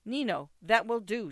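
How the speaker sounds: sharp cry. A narrator's reading. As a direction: cry out to cut him off. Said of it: "she cried".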